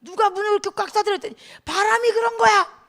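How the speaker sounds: high, indignant acted scolding; hammed-up parental yell; mock angry dad. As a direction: play a furious father calling the kid out. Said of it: "아빠가 화가 나가지고".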